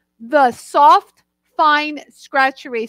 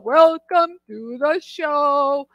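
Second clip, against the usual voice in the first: show host greeting; slower than usual. Acted. Playful show-host welcome.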